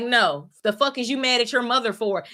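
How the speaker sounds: ordinary delivery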